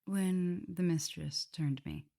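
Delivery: ordinary delivery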